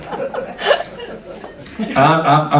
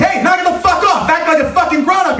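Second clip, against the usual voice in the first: higher, parent-style scolding yell; mock scolding; 11 semitones higher than usual. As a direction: scold the kid like a fed-up dad. Scolding an inner manic child.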